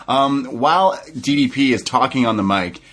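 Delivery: usual speaking voice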